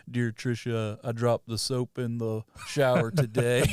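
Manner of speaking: slow, put-on Southern drawl reading a joke prison letter; mocking Cage's accent. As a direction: drawl it out like a bad movie Southerner. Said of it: "That was a bad accent".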